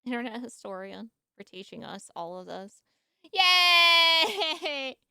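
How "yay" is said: cheering. Real, not acted. Cheering at video end.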